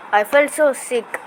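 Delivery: ordinary delivery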